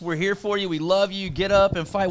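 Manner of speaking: supportive cheering. Shown as a demonstration. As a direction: demonstrate rooting for your fighter. Models supportive cheers for fans.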